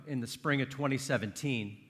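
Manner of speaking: ordinary delivery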